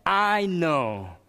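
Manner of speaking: a loud, high, upset I know; upset outburst. Retold or imitated. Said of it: "Then the boy became upset".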